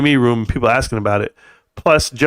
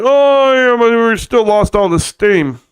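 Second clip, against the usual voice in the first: high, loud drawn-out mock whine; hammed-up naysayer voice; mock complaint; 7 semitones higher, 4 dB louder, slower than usual. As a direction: stretch out a high, mocking "Oh" and carry it through. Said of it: "'Cause they'll be like".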